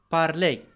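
بارلي said the French way, final r silent, ending in a clear e sound; French pronunciation. Shown as a demonstration. Teacher showing students French parler with a silent final r. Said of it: "في الآخر عندما سبقتها أو أصبحت إي".

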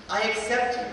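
normal talking voice

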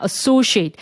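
associate slowed, stress on "so"; drawn-out associate, hitting the second syllable; stress demo. Shown as a demonstration. Teacher modelling associate's stress for students. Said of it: "강세가".

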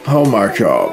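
ordinary delivery

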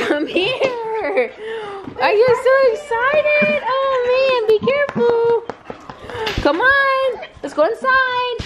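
very high, squealing greeting to the dogs; excited pet talk. Real, not acted. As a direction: coo and squeal at overexcited dogs. Greeting excited dogs on arriving home.

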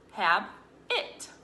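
"have it" as two chopped syllables. Shown as a demonstration. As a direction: split habit into "have", "it". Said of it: "chop it into syllables".

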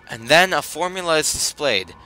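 reading game text with gravitas; storyteller reading aloud; narration read. Acted.

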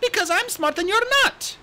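put-on high, bright young dragonette voice; chirpy excited young dragon; eager little RainWing voice; Kinkajou's voice. Kinkajou teasing.

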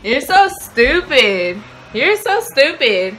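slow, stretched you're so stupid; relieved drawn-out scolding. Real, not acted. Girlfriend after learning the hickey was a prank, slow mock scolding. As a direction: drag it out in mock disgust.

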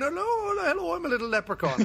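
high-pitched mock Irish leprechaun; Irish leprechaun accent. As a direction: slip into a quick, hammed-up Irish accent. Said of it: "in between the two accents quite wildly".

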